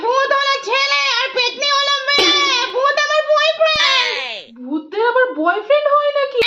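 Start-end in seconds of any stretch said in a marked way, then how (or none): 0.1-4.5 slow sing-song spooky voice, pitched higher; a slow singsong ghost explaining herself. Acted. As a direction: chant it like a hammed-up ghost. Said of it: "আমি পেতনি".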